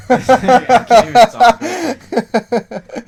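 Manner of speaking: high, slow laughing through words; can't stop laughing. Real, not acted. Laughing fit.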